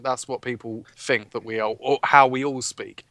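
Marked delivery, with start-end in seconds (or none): none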